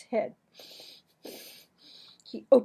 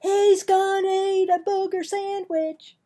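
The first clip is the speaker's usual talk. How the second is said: bully announcing; 10 semitones higher, 10 dB louder than usual. Acted. Clarence tells everyone Marvin will eat it, very high loud jeer. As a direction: announce it to the whole table.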